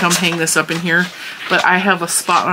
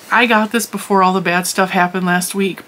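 normal talking voice

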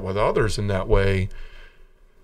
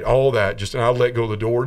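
normal talking voice

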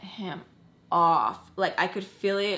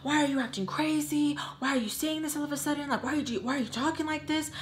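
retold outburst; 6 semitones higher than usual. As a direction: rattle off accusing questions in a higher pitch. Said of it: "he loses his shit".

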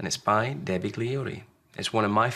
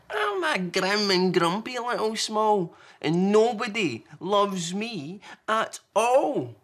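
put-on high, slow, sulky child voice; Small, grumpy little one; 11 semitones higher, 4 dB louder, slower than usual. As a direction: whine it like a grumpy little creature. Small's voice, sulking.

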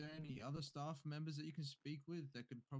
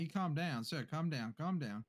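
urgent raised voice; 9 dB louder than usual. Acted. Officer faces armed old man.

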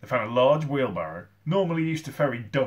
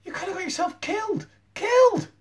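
high-pitched telling-off, exploding on the last word; alarmed scolding; 7 dB louder than usual. A narrator's reading. Sid to Eric after the gunshot, scolding. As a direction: scold in a high voice, building to a shout.

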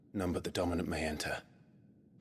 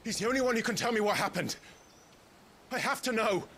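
high, loud, urgent plea; straining loud with need; desperate outcry; 4 dB louder than usual. Acted. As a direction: cry out, desperate to know the truth. Desperate urgent plea about Clive.

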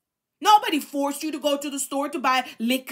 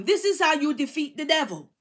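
normal talking voice

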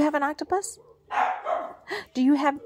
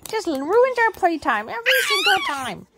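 mock exasperated; 7 semitones higher than usual. Real, not acted. Complaining to baby about dogs.

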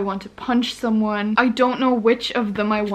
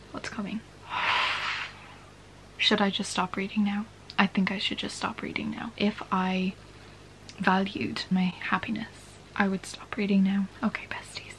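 hushed whisper, half voice gone; 7 dB quieter than usual. Real, not acted. Late-night reader whispering her progress to viewers. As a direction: keep it to a breathy hush as if someone sleeps nearby.

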